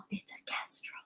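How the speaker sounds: gasped, hushed wonder; awed whisper. Real, not acted. Streamer whispering the bird's name in awe. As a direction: breathe it in awe.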